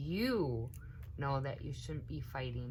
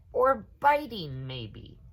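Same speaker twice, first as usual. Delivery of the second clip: louder, higher pointed ask; suspicious question; 3 semitones higher, 9 dB louder than usual. A narrator's reading. Cousin questioning the children, pointed. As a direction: lean on it like a doubting grown-up.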